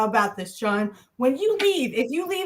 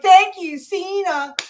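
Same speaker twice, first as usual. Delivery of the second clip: mock crowd cheer; 6 semitones higher, 5 dB louder, slower than usual. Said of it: "They're like".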